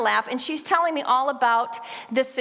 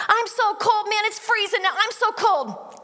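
put-on whiny child; 10 semitones higher than usual. Child complaining to parent after the bath, high whiny voice. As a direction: whine it like a shivering five-year-old.

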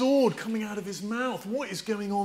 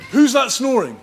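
loud playful call; 6 semitones higher, 12 dB louder than usual. Real, not acted. Playful call-out to sleeping child.

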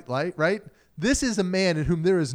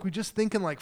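normal talking voice

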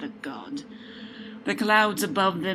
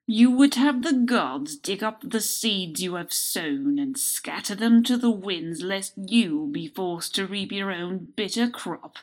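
louder, higher contemptuous rebuke; scornful old sage; 4 semitones higher, 7 dB louder than usual. A narrator's reading. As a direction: build to a disgusted challenge. Wise man scorning the Winter Lord.

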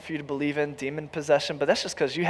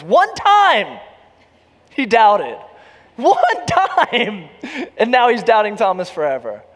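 louder, higher indignant stress on One time with a laugh; emphatic preaching; 6 semitones higher, 7 dB louder, slower than usual. Acted. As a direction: defend Thomas with a laughing protest. Preaching at full force.

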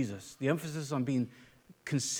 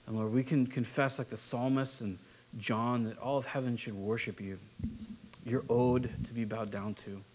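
closing prayer; 5 semitones lower than usual. Acted. Pastor leads closing prayer.

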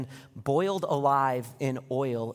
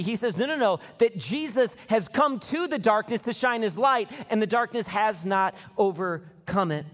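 raised, louder preaching voice; impassioned sermon delivery; full-force preaching; 8 semitones higher, 5 dB louder than usual. Acted. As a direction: preach it out to the church.

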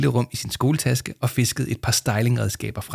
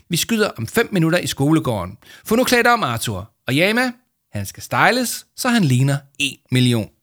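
calling out; 6 semitones higher, 5 dB louder than usual. A narrator's reading. Victoria calling while hurrying away.

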